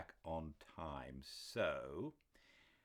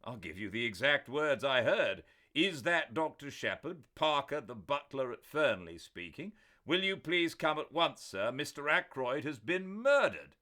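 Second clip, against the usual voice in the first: loud, higher urgent recital; urgent retelling; 10 semitones higher, 11 dB louder than usual. A narrator's reading. Doctor repeating the caller's message to Parker. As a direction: rattle off the caller's words.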